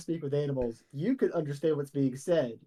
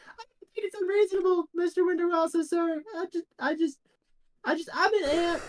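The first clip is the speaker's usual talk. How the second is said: very high, deferential ant; hesitant high-pitched little ant; tiniest little voice. Acted. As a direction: squeak it humbly as a tiny ant addressing a superior. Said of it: "the tiniest little voice go".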